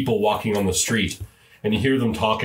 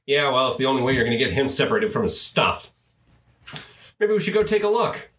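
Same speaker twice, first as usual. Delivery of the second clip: fast, higher gnome chatter rising at the end; 4 semitones higher, faster than usual. Acted. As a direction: rattle it off quickly as the gossiping gnome.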